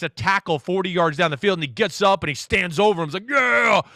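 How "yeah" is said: taunting defender. Retold or imitated. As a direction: crow over him like a defender.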